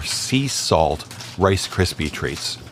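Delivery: ordinary talk, nothing marked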